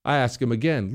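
usual speaking voice

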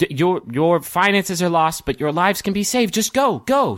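high, rising urgency; urgent command. Acted. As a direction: drive them out, pressing harder. Raven urges them to flee.

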